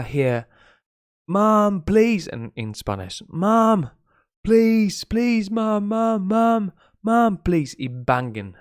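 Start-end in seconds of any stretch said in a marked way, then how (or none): 1.3-2.3 put-on high pleading child's voice, raised pitch; a small kid begging his mom at the door; imitating a begging child. A child pleading with mom to open the door. 3.3-7.7 put-on high pleading child's voice, raised pitch; a small kid begging his mom at the door; imitating a begging child. A child pleading with mom to open the door.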